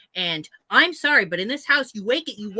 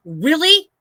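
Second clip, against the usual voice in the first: exasperated really; 7 semitones higher, 7 dB louder than usual. Retold or imitated. Opening door on pounding neighbor.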